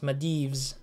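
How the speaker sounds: Maldives said as ma-DEEVZ, with no L; alternative pronunciation. Shown as a demonstration. Game streamer making a last guess at the title, Maldives pronounced ma-DEEVZ. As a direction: say Maldives with a long EE, ending like leaves. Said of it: "don't know how to pronounce".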